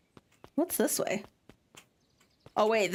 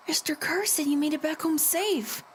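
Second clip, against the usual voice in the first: usual speaking voice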